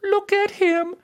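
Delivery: very high, ridiculing princess voice; mocking princess. A narrator's reading. Princess making fun of a good king, mocking. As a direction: point him out in high-pitched scorn. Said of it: "she laughed more than all".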